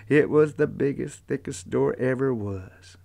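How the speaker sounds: ordinary delivery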